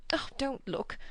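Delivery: high, whispered warning; hushed whisper. A narrator's reading. Missus Honeychurch warning Lucy not to look, whispering. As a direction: whisper it so nobody hears. Lady averting eyes, hushed.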